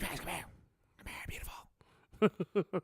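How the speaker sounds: slow whispered come-on; imitating a cheesy whispered come-on; mock whisper. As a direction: coax her over in a hushed voice. Friend beckoning waitress.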